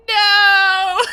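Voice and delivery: high, loud cry of protest; anguished no. Retold or imitated. Reacting to Shroud's betrayal, a loud anguished no. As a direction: wail out no at the twist. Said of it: "I was like".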